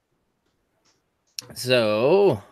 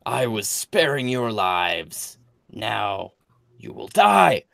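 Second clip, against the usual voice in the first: booming echoing villain monologue; 5 dB louder than usual. Acted. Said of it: "and says".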